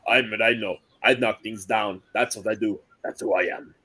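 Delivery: put-on Rhino in a Russian accent. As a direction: growl it out like a Russian brute. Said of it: "Good Rhino impression".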